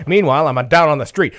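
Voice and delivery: ordinary delivery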